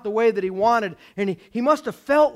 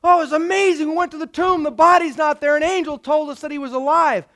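high, loud, excited report; acting out breathless-with-news excitement; astonished; 8 semitones higher, 6 dB louder than usual. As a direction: blurt out amazing news after running back. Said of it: "The women were astonished".

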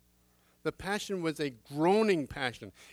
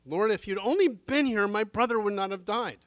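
pleading cry; 7 semitones higher, 8 dB louder than usual. Acted. Martha grieving, pleading to Jesus.

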